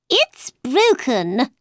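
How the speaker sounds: a touch of anger, drawn out; angry. A narrator's reading. Mad Hatter to March Hare about the watch, mildly angry. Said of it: "he said angrily".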